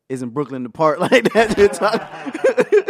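laughing retort. Retold or imitated. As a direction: laugh it out in disbelief.

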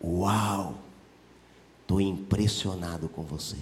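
low, soft, understated admiration; Jesus. Acted. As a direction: say it quietly, as if deeply impressed. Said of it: "Sabe o que Jesus disse?".

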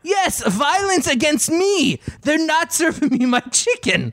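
angry but British. Acted. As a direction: insist it's an emergency, hammed-up. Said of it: "angry, but British".

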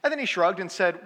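ordinary talk, nothing marked

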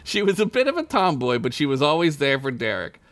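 usual speaking voice

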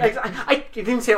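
normal talking voice

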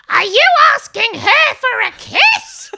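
high, loud, slow outraged shriek; yelled over crowd. Retold or imitated. Woman shouts at her son across merch desk, high outraged yell. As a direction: imitate a scolding mum heard above a din. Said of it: "I hear over the top of, like, the, the roar".

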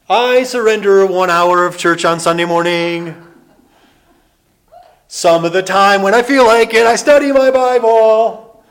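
hammed-up loud parody of easy faith; mocking half-hearted believer. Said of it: "A lot of people say".

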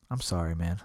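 low, soft imitation of a sorry dad; subdued apology. Dad apologizing to his son, low and soft. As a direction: drop the voice, apologize sincerely. Retells dad's sincere apology.

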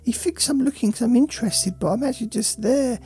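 sulky, bored dog complaining about its owner; a neglected pet moaning, half whisper at times. Acted. Voicing the dog's thoughts.

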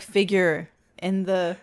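normal talking voice

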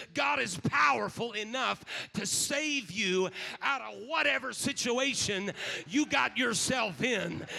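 usual speaking voice

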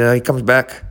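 ordinary talk, nothing marked

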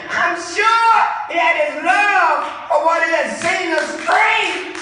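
high, loud swelling proclamation; full-force preaching. Real, not acted. Preacher imagines what moved the man, loud preaching. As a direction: build it up for the congregation.

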